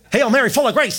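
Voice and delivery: hammed-up childish prayer; mock kid reciting. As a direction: rattle it off like a proud kid.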